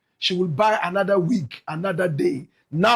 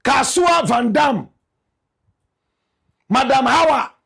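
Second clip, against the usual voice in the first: called-out nickname; 4 semitones higher, 4 dB louder than usual. Acted. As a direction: announce her nickname like a ring MC. Calling out her nickname.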